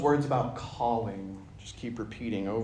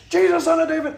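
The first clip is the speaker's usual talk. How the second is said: much higher, loud, insistent cry; shouting louder; 8 dB louder than usual. Acted. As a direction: shout it above the shushers. Blind man shouts louder despite shushing.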